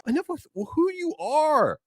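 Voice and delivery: fed-up retort. Retold or imitated. To a friend repeating he looks tired, high fed-up snap. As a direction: snap back, completely done with it.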